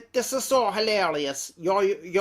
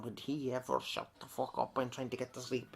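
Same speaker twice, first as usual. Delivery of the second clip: imagined mutter; 5 semitones lower, 11 dB quieter than usual. Retold or imitated. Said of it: "in the back of your mind sometimes going".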